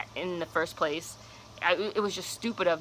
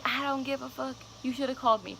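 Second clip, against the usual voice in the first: scolding friend; 6 semitones higher than usual. Retold or imitated. Friend voiced scolding again.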